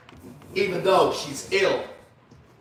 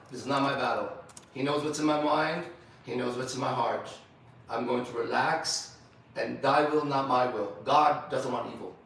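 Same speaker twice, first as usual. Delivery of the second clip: quiet, calm, trusting prayer, sinking softer as it goes; 7 semitones lower, 4 dB quieter than usual. Shown as a demonstration. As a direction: pray it softly, handing the burden over to God. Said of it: "Here's prayer. Ready?".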